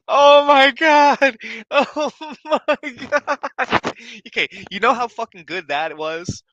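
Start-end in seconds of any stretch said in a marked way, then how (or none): 0.1-3.4 slow, high, overjoyed exclamation; laughing disbelief. Real, not acted. Reactor reeling from the bleachers bar, laughing disbelief. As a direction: draw it out high, floored and laughing.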